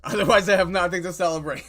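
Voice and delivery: loud, fast laughing line. Real, not acted. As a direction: laugh through it. Laughing at own joke.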